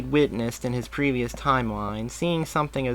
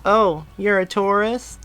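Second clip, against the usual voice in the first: put-on high, bright kid voice; young boy; 9 semitones higher, 5 dB louder than usual. A narrator's reading. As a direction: play an eager little boy. Boy asks Barry.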